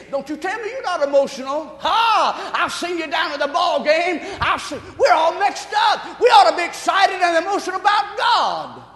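fired-up pulpit delivery; full-force preaching. Real, not acted. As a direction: challenge the congregation loudly and high.

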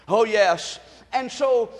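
ordinary talk, nothing marked

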